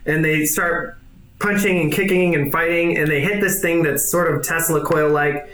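ordinary delivery

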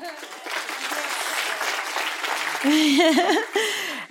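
long laugh, rising high. Real, not acted. To the audience after a moving memory, long laugh. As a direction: let a long laugh roll out.

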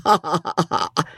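acted jeering ha-ha; mocking cook's laugh. A narrator's reading. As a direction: laugh in the mistress's face. Cook laughs at her mistress.

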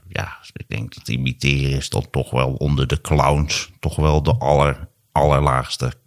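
low, slow grumpy drone; Maarten van Rossum impression. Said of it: "dit was Maarten van Rossum".

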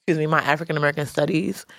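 normal talking voice

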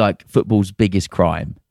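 normal talking voice

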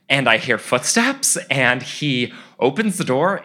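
plain reading voice

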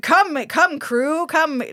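rally the troop to action; hammed-up call to the crew.

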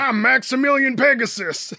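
high, hammy highfalutin tycoon; booming oil tycoon drawl. Retold or imitated. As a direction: play it like a pompous oil baron. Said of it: "highfalutin oil tycoon-ass guy".

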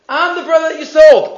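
high, loud, pressing on "sold"; hammed-up reproach, "sold" hit hard; mocking emphasis. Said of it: "Is this the phrase that one would say".

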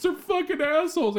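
put-on high, wailing kid voice; son's tearful outburst. Father imitating his son's teary verdict, high wail. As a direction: blubber it out like a crying boy. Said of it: "He goes".

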